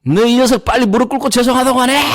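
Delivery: a high, mock angry yell at the son; shouting at son. Father yelling at his son to kneel and apologize, mock shouting. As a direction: bark the order at the boy, hammed up. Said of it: "과음을 꽉 질렀어요".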